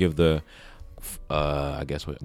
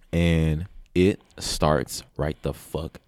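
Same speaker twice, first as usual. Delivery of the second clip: slow announcer countdown; much slower than usual. Acted. Host starts the clock.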